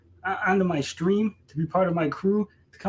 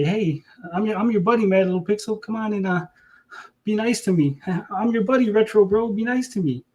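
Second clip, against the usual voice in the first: higher, wheedling mock buddy voice; mock sucking-up voice; 4 semitones higher than usual. Streamer mocks rival begging other streamers, higher wheedling mock voice. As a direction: whine it like a groveling suck-up. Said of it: "run back over there and say".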